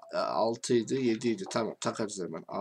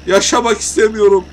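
loud, much higher hammed-up wailing; mock despairing wail; 7 semitones higher, 11 dB louder than usual. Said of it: "Biraz çıldırdım".

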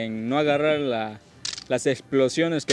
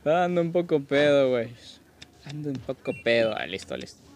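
ordinary talk

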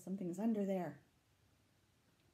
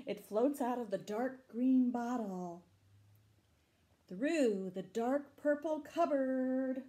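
louder story reading, building tension; reading the beast chase to children, louder and tense; dramatic storytelling; 5 semitones higher, 8 dB louder, slower than usual. A narrator's reading. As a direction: read it to the kids like something is coming.

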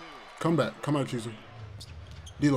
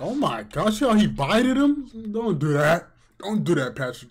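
high, loud disbelief at the foul; stunned loud reaction; real excited outcry; 5 semitones higher, 4 dB louder than usual. Real, not acted. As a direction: yell it out in shock.

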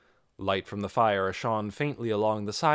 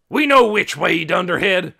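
loud, raised-pitch rebuke; scornful shout; 9 semitones higher, 13 dB louder than usual. A narrator's reading. Trog rebuking his dim companion, loud scornful shout. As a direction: shout down a fool. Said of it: "Trog shouted".

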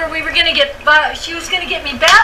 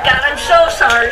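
ordinary talk, nothing marked

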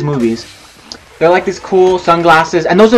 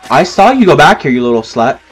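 ordinary delivery

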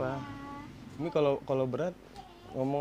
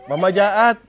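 laughing accusation; 4 semitones higher, 12 dB louder than usual. Real, not acted. Mock accusation to mother laughing.

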